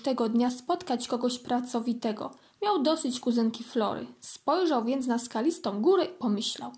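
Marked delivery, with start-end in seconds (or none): none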